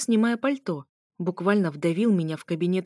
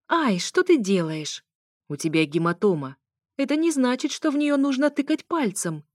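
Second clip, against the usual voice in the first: higher, louder indignant protest; 6 semitones higher, 3 dB louder than usual. Acted. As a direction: object sharply to being poked. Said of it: "возмутилась я".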